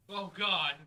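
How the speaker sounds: loud, high shocked outburst; sudden realization. Real, not acted. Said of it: "You just realized?".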